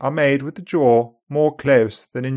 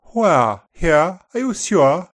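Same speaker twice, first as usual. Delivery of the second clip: upper RP accent; 5 semitones higher than usual. Shown as a demonstration. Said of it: "open endings in upper R P".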